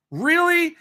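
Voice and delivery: high, loud, incredulous question; surprised disbelief. Real, not acted. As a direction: shoot way up in pitch, not believing it. Reacts to news of publisher.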